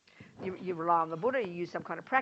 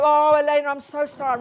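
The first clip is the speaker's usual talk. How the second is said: higher, louder, gushing guilty apology; panicky apology; 6 semitones higher, 12 dB louder than usual. Retold or imitated. Teacher acting out guilty apology to friend, high and loud. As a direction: blurt it out in a guilt-ridden panic.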